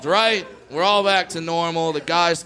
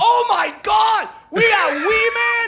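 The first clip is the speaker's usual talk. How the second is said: high, loud, thrilled shout; excited shout; 5 dB louder, slower than usual. Retold or imitated. Golfers thrilled to meet Wee Man, shrieking. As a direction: shriek it like a starstruck fan. Group's excited reaction, retold.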